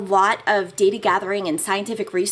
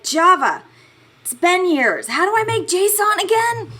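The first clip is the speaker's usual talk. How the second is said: exasperated; 10 semitones higher, 5 dB louder than usual. Retold or imitated. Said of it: "and I'm like".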